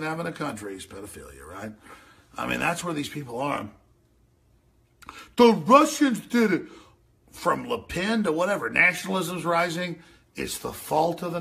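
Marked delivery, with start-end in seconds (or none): 5.4-6.6 high, loud mock voice; high loud mock of accusers; mocking whine. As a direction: sneer it like a whiny critic. Mocking the Russia claim.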